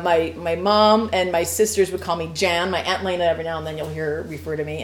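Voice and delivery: ordinary talk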